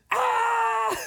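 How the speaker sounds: loud, very high scream; panicked mock scream. Retold scream at parents arriving.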